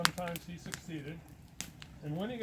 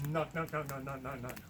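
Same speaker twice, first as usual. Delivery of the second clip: rapid low percussive repetitions; imitated knocking; 3 semitones lower, 5 dB quieter than usual. Imitating sounds heard from inside the tree, rhythmic knocking. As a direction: lightly mimic knocks and booms as sound effects. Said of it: "you hear from inside the tree".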